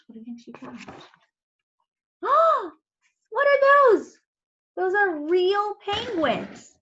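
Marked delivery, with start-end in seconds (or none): 2.2-2.7 sharp surprised gasp, high; big gasp. Acted. Reader gasping for the children. Reading picture book to kids. 3.3-6.7 high, loud astonished question; amazed. Acted. Harriet staring at the penguins, amazed. Reader voices Harriet's surprise.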